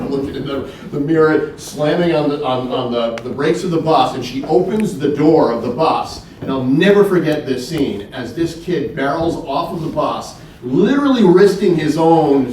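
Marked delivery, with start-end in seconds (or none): none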